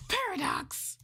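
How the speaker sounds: high startled shout of the name; shocked call-out. Real, not acted. Streamer catching a viewer about to report her, shocked. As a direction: catch him in the act.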